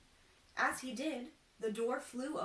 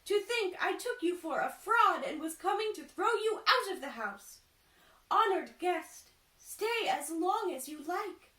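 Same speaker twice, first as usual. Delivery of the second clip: high, loud amazed woman; awestruck merchant's wife; 8 semitones higher, 8 dB louder than usual. Acted. Merchant's wife marvelling at the change, high loud awe. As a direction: gush with awe.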